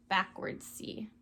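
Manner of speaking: whispered. Shown as a demonstration. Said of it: "letter demo".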